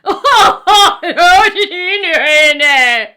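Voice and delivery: loud villainous cackle; big evil laugh. Acted. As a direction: laugh like a hammed-up monster. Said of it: "a big evil laugh".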